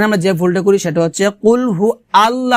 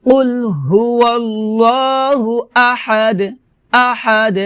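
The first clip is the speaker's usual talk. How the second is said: higher, slow held recitation; Quran recitation; 4 semitones higher, slower than usual. Shown as a demonstration. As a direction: chant the verse with long level vowels. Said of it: "লাস্টে কলকলা করতে হবে".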